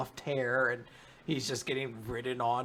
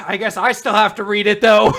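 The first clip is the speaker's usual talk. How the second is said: loud grudging sigh; 6 semitones higher, 16 dB louder than usual. Retold or imitated. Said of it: "You're like".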